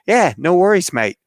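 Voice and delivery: imitated Kiwi, high and loud; mock New Zealand assurance, dropping on MATE; breezy New Zealander. Skydive staff wave off the pregnancy, imitated New Zealand accent.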